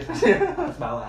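high, slow and laughing; slow laughing squeal. Acted. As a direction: stretch it out through a laugh. Joking reply, slow high laugh.

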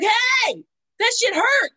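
high, loud complaining yelp; mock angry yell. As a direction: yelp it like the swab just hurt you.

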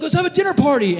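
high, perky mock invitation; hammed-up party cheer; chirpy host. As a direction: propose it with silly glee. Acting Joseph as eager host.

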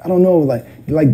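normal talking voice

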